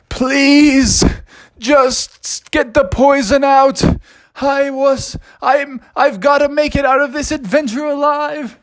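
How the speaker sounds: high, melodramatic raccoon plea; desperate pleading. Acted. Poisoned raccoon begging for the cure, melodramatic pleading. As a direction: beg in a high, drawn-out whine.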